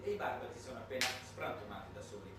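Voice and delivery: ordinary talk, nothing marked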